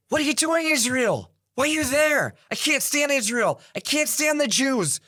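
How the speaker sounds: high-pitched mock yelling down the phone; retold angry screaming. Retelling a Persian woman screaming at him on the phone, high mock yell. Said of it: "She's screaming at me on the phone".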